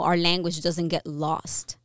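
ordinary talk, nothing marked

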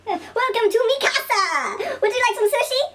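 hammed-up host welcoming customers; mock restaurant greeter. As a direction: greet diners in an exaggerated high voice. Acts greeter at misread Spanish restaurant.

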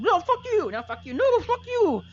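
shrieking, very high, loud toad voice; a tiny toad screaming insults back and forth; squeaky bullywug rage. Acted. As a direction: screech it like an enraged little frog creature. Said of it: "and he's just".

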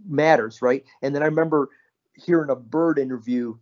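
ordinary talk

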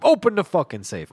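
mock threatening shout; robber's shouted order. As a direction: order the clerk about at gunpoint.

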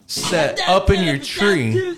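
slow shout into a laugh. Real, not acted. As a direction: yell it out, then crack up. Incredulous retort, shout heard.

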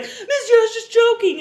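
high, loud mock plea; pleading backpedal. Imitating him backpedalling to her.

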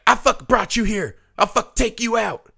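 high-pitched retold threat from an angry father; mock aggressive dad. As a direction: imitate a dad yelling at his kids without real volume. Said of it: "he was, like, aggressive".

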